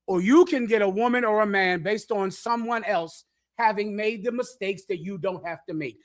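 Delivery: ordinary talk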